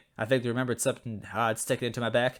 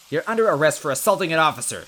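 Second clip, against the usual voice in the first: loud, higher barked order; yelled arrest; 5 semitones higher, 7 dB louder than usual. Acted. Officer arresting Luigi, loud yelled command. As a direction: shout it at the culprit. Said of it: "she yelled".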